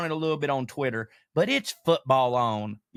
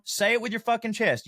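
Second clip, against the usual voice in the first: usual speaking voice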